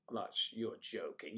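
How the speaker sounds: ordinary talk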